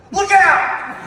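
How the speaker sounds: very high, loud yelled Look out!; mock warning shout. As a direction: yell it from behind a tree. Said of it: "I hear the hobo".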